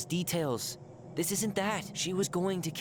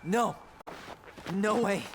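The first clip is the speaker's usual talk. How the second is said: angry. Acted. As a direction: spit the accusation. Accusing in a quarrel.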